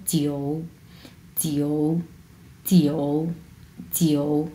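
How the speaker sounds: "জিও" low, dipping and drawn out; third tone. Shown as a demonstration. Teacher modelling Chinese nine, third tone. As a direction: sink low through "জিও", keeping the o. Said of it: "তিন number tone কীভাবে উচ্চারণ করবো".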